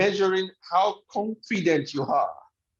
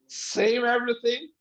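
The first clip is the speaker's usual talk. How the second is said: ordinary delivery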